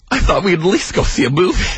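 gasping, tearful plea, put on; mock crying. Clingy date pleads to see a movie, mock crying. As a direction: imitate a tearful date after a gasp. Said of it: "he starts to cry".

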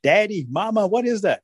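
put-on high, curious child voice; child asking parents; little child. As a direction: pitch it up like a curious kid. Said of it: "little boy or girl's gonna say".

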